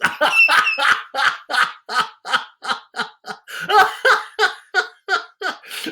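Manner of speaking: high, hammed-up cackle of the ugly sisters; mocking sisters' laugh. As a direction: laugh at her like a spiteful sister. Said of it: "laughed the sisters".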